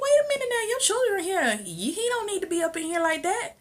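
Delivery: very high-pitched mock mama; objecting mama. Mama objects to visitor around children, high protesting imitation.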